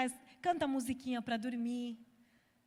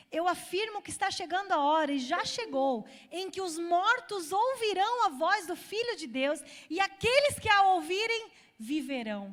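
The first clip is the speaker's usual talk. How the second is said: rising preaching shout; 4 semitones higher, 6 dB louder than usual. Acted. Climax of read scripture verse.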